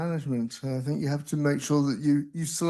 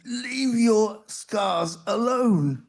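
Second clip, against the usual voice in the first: slow, emphatic mock shout, pitched higher; retold forceful shout; 5 semitones higher, 3 dB louder, much slower than usual. Patient retelling how the nurse shouted at him, forceful mock shout. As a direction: imitate a forceful nurse, drawing out each word. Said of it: "the nurse shouted".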